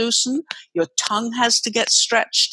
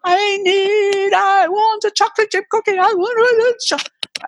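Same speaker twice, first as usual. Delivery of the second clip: put-on toddler wanting a cookie, whining at the parent in the kitchen; whiny toddler demand; 12 semitones higher than usual. Toddler demanding a cookie from the parent, a high whine. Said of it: "I'm not going to do the full volume".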